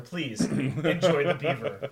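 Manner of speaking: loud, slow, drawn-out mock announcement; mock-emphatic plea. Host jokingly urging the dear listener to enjoy it, mock-emphatic plea. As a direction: plead grandly and loudly, playing it up.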